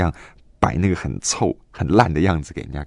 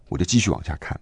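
normal talking voice

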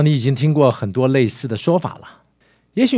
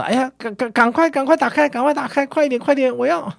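put-on high-pitched, impatient child urging; 急着叫喊的孩子; 11 semitones higher than usual. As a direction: act out an eager child begging, high and stammering. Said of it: "这个孩子就在一旁叫着". (模仿孩子急切尖声催促)

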